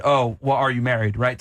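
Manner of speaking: ordinary talk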